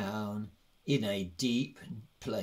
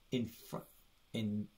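usual speaking voice

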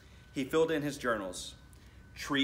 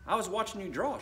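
put-on higher, innocent little girl; little sister voice; 9 semitones higher than usual. Little sister explaining to her brother, higher innocent voice. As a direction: sweetly answer as the little sister.

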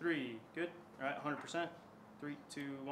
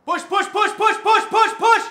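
high, rapid-fire shouted chant; urgent coaching shout; 17 dB louder, slower than usual. Real, not acted. Coach urging a lifter through the push, urgent shout. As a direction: yell it to drive the lifter on.

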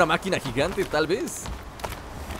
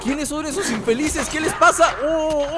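alarmed indignation; 7 semitones higher, slower than usual. Real, not acted. Player alarmed at attackers.

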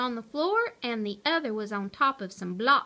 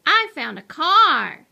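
loud, raised-pitch call; storybook yell, high and loud; excited yell; 6 semitones higher, 10 dB louder than usual. A narrator's reading. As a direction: yell out your find. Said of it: "yelled Mo".

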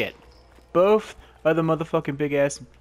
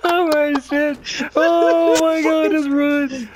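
laughing panic; 9 semitones higher than usual. Real, not acted.

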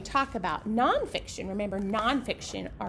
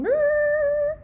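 high, loud engine roar; loud pretend engine noise; 8 dB louder than usual. Book's fire engine roaring.

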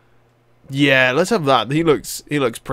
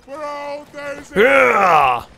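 battle cry; 11 semitones higher than usual. Pretend. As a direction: let out a mock war cry. Mock battle cry while playing.

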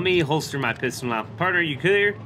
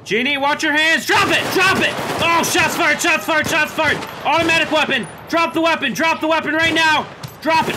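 shouting commands; 12 semitones higher, 5 dB louder than usual. Pretend. Gunfight, commanding armed suspect.

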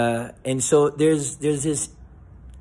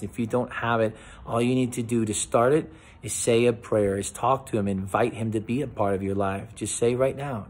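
ordinary delivery

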